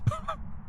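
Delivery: quick high breathy laugh; a brief laugh at the broken mic; short laugh. Real, not acted. Laughing that his mic still fails, quick high laugh.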